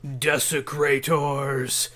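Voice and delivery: very slow, drawn-out tomb voice; booming voice. A narrator's reading. Game master replaying the voice in the secret tomb, booming. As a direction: boom it out with long stretched syllables. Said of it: "as the voice booms".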